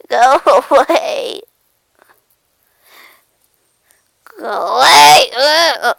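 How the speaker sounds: loud, drawn-out whine; upset shooing. Acted. Cat telling something to go away, upset. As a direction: wail it as you shoo it off.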